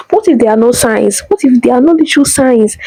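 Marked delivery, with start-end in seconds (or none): none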